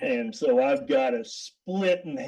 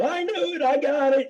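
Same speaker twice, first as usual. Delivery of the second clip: high, excited retold cheer; imitating inmates' gleeful outburst; mock triumphant crowing; 11 semitones higher than usual. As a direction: crow like you just won the bet. Inmates crow over winning bet.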